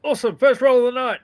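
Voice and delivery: loud and high, eager; pumped about the roll; excited. Real, not acted.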